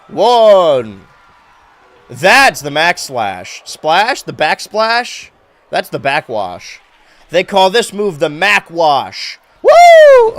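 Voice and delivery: drawn-out loud ringside call; slow, booming announcer naming the move; wrestling commentator. Acted. Live play-by-play of the match.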